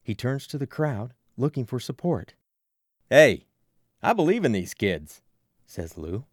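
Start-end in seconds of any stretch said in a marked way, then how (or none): 3.1-5.2 loud hey, then firm declaration; rallying call. Acted. Lou rallying the crowd behind the kids, loud.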